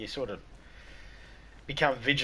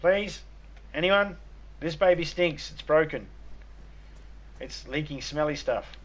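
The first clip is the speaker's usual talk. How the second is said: higher, pleading mock complaint; hammed-up parent asking for a new baby; mock complaining parent; 6 semitones higher than usual. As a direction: whine like a parent returning a faulty baby. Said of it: "you're like".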